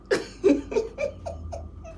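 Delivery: high, breathy laugh on the edge of tears; tearful laugh. Real, not acted. Laughing through tears as she tells viewers where she started. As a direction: laugh to hold back crying. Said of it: "that's why I'm laughing".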